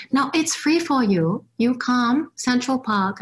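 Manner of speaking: put-on high Chinese-accented woman urging her to come. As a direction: imitate the Chinese lady on the phone, Chinese accent. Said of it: "I butchered that Chinese accent".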